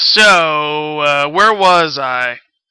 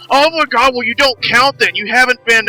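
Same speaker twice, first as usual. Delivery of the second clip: mocking viewer; 7 semitones higher than usual. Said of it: "they're like".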